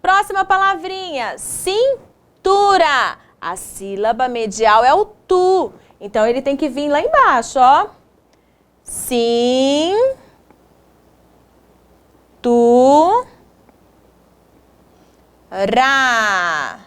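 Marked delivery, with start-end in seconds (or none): 1.4-3.2 slow, split after the first syllable. Shown as a demonstration. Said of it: "separamos essas palavras em sílabas". 8.8-16.8 each syllable drawn out, long pauses between; syllable-by-syllable dictation. Shown as a demonstration. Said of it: "separamos essas palavras em sílabas".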